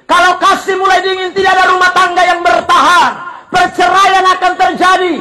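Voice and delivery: loud, forceful sermon shouting; fiery preaching. Acted. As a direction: warn the flock with full force.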